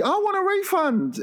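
very high put-on female voice; high-pitched mock complaint; complaining woman. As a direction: demand it like a caricatured customer. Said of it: "the woman is just like".

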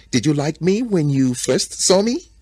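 high, coy teasing question; teasing lioness. A narrator's reading. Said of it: "the lioness teases him".

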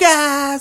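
high-pitched imitated scream; retold childhood shriek; mock scream. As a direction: cry out like a freaked-out little kid.

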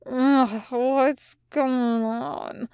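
slow, quiet, half-awake slur; slurred. Acted. Demon shaken awake by the angel, slow quiet slur. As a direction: mumble it groggily, barely conscious. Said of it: "the demon slurred".

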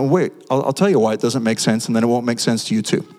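ordinary talk

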